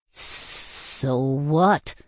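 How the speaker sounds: halting, long hissed first sound; stuttered. A narrator's reading. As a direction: stick on the first S before getting it out. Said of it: "I stuttered".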